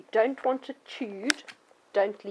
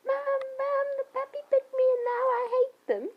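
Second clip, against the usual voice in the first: put-on much higher, whiny child voice; whining child; 4 dB louder than usual. Child complaining to mum about the puppy, high whine. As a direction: wail to mum like a hurt kid. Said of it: "You don't go".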